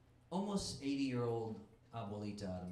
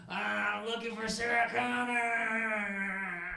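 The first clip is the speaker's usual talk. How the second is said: slow, loud, much higher put-on robot voice; Terminator; 9 semitones higher, 7 dB louder, much slower than usual. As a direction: boom it out like a movie cyborg. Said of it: "he goes".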